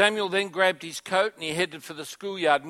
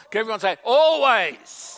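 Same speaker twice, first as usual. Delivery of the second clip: much higher and louder, Always belted out; rousing call; 6 semitones higher, 8 dB louder than usual. Shown as a demonstration. Pastor urging church to answer, loud and rousing. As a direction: pump the crowd up on Always. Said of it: "Oh, come on, come on".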